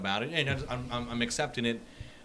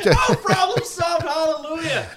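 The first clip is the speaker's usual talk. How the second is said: hammed-up triumphant shout, much higher and slow; mock hallelujah; 10 dB louder, slower than usual. Friend mocking the simple advice with a celebratory shout. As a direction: shout it like a revival-tent miracle.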